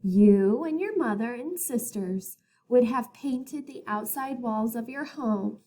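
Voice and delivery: usual speaking voice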